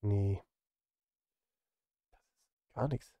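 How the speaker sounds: ordinary delivery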